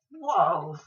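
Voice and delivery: a loud startled whoa; surprised whoa. Retold or imitated. Telling Rose across the room, a loud whoa. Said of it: "and I said".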